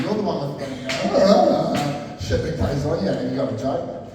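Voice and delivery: ordinary delivery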